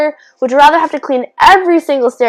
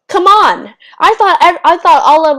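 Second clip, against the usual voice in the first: high, louder cry of betrayal; indignant outburst; 8 semitones higher, 4 dB louder than usual. Real, not acted. Complaining to viewers about the movie ending, pitched up and louder.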